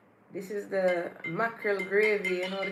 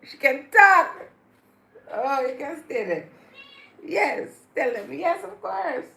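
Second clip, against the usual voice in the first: loud, high, delighted chatter to the cat; excited cat talk; 4 semitones higher, 11 dB louder than usual. Real, not acted. Praising the cat for talking, a loud delighted chatter. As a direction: gush over the talking cat.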